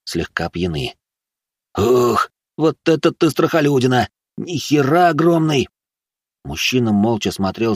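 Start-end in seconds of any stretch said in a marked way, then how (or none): 1.8-5.7 raised-pitch rowdy taunt; jeering drunk youth. Acted. Drunk lads showing off before girls, jeering. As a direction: gawk and jeer in a higher voice.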